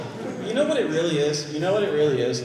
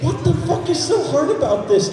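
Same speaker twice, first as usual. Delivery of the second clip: mock outrage; 9 semitones higher, 4 dB louder than usual. Real, not acted.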